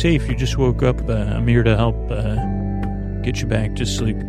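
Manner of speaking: ordinary talk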